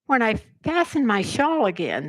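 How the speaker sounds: plain reading voice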